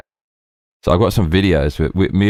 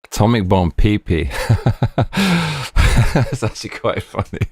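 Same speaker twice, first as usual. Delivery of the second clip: laughing; 3 semitones higher than usual. Real, not acted. Laughs at his own joke.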